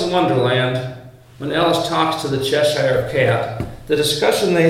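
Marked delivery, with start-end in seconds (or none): none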